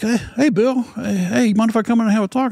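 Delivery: friendly commander. Retold or imitated.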